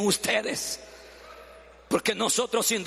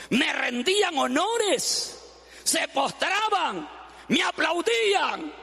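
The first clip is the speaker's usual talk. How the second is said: boastful donkey; 7 semitones higher, slower than usual. Acted. Donkey boasting of his welcome.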